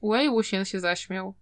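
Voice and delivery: plain reading voice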